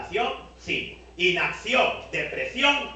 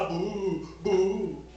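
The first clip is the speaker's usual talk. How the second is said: imitated whimpering boo-hoo; mock a grown woman crying in bed; lightly mocked whimper; mock sobbing; 2 semitones higher, 3 dB louder than usual.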